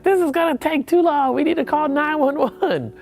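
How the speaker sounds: high, hurried worried friend voice; urgent friend. Retold or imitated. Friend insisting on 911 over his heart attack, high urgent voice.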